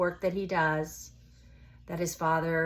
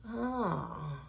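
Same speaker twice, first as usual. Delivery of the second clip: ordinary delivery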